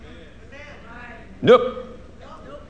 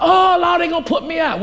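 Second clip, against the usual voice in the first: alarmed "Oh, Lord" cried out high and loud; panicked cry; 11 semitones higher, 4 dB louder than usual. Retold or imitated. Believer seeing an eviction sign, panicked cry. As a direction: blurt it in sudden panic.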